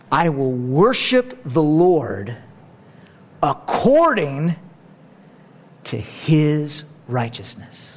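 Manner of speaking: slow, hushed, reverent reading; reverent quote. Retold or imitated. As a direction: let the words land slowly with awe. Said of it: "David says".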